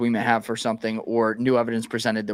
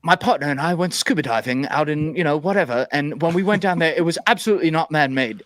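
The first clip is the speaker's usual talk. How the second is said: a loose, higher imitation of his accent; half-hearted take on Hancock's accent, a bit fast at the end; admittedly bad Graham Hancock accent; 4 semitones higher than usual. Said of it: "I can't do his accent very well".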